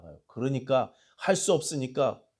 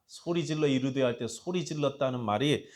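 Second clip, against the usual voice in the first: ordinary delivery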